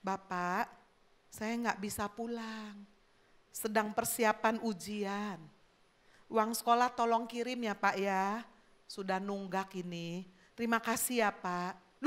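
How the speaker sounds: softly read, tender letter; gentle and soft. Acted. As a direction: read it gently, like a polite plea. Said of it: "Yang satu dengan lemah lembut".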